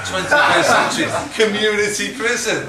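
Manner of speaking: mocking the slogan while cracking up; laughing disbelief. To the audience, mocking the prison sign, laughing loudly. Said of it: "that's just ridiculous".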